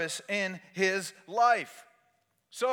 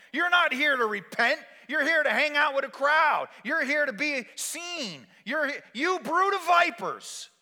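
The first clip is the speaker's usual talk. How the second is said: high-pitched, forceful accusation; acted prophet's denunciation; accusing rebuke; 6 semitones higher than usual. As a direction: confront the crowd like a preacher. John confronts the crowds.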